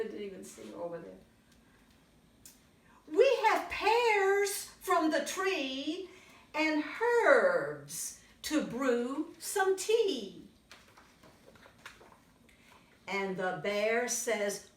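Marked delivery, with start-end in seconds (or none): 3.1-14.7 put-on lively read-aloud for little kids; storybook reading. A narrator's reading. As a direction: tell it brightly to a young audience. Reads picture book to children.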